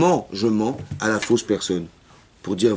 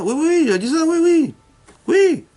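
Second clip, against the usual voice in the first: much higher, louder, insistent yeses; eager confirmation; 4 dB louder than usual. Acted. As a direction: pitch it up high to sell the reconciliation. Mediator eagerly confirms to rival. (très aigu et plus fort, pour convaincre)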